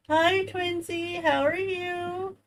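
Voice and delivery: high, slow coo to a pet; sweet pet-talk greeting. Real, not acted. Greeting her pet, baby talk.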